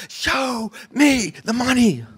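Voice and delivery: high, drawn-out mock yell; imitated yelling. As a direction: stretch each word into a high-pitched movie yell. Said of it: "he starts yelling it at the top of his lungs".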